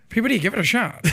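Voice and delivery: put-on old-timey voice. Urging the host to try the old-timey voice. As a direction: dare him like a newsreel announcer. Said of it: "it's very fun to talk in that voice".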